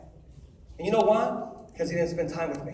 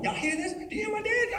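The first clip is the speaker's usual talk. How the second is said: put-on much higher, excited kid voice; excited child; 13 semitones higher than usual. Son asking classmates if they hear dad, higher and excited. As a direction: ask the room like an amazed little boy. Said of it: "start walking around going".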